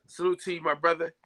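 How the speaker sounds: ordinary talk, nothing marked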